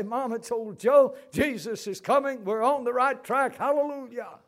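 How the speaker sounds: high, loud, rejoicing; joyful exclamation. Retold or imitated. Son told to cheer his comatose mother, high and loud. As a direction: shout the good news to her.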